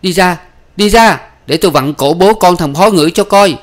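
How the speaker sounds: high, loud bellicose threat; fierce, fired-up yell; aggressive shouting. Acted. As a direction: shout them out of the way. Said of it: "Bác Nhân gái vẫn hùng hổ".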